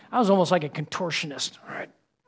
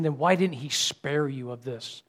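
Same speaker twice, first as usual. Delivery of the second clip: normal talking voice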